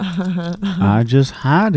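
drawn-out southern twang; southern drawl. Pretend. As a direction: play along in a stretched southern accent. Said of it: "had this southern drawl".

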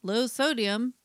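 punchy, playful sponsor spot; mock ad read. Podcast host plugging peanuts as a sponsor, mock ad read. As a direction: sell the snack with emphatic delivery.

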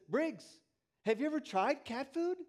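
put-on tempting older boy.

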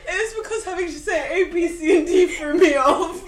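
laughing so hard it sounds like sobbing; words breaking into sobs of laughter. Real, not acted. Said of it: "You sound like you're crying".

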